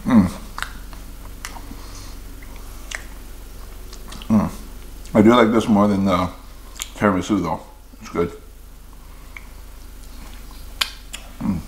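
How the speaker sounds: chewing while talking; muffled between bites; mouth full of donut. Real, not acted. Seen 5: Holds donut at mouth, biting and chewing throughout the words.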